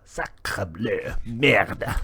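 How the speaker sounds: put-on playful French accent swearing. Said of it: "mild profanities in French".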